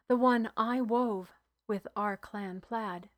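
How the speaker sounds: plain reading voice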